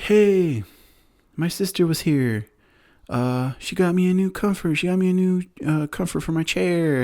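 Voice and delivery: imitating an excited kid in a high-pitched voice; Danny. Danny telling friends about his new comforter, high-pitched imitation. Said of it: "then Danny's like".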